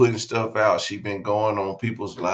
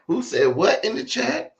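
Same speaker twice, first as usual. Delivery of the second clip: higher rising imitated question; mock host question; 13 semitones higher than usual. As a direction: mimic her asking the chat. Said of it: "She like".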